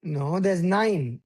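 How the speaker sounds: nine with a long held n; stretched final n. Shown as a demonstration. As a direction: draw out the n in nine. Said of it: "I wanna hear the L".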